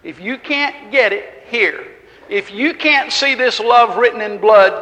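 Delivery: full-force preaching. Acted. Preacher urging the congregation to believe, fervent and loud.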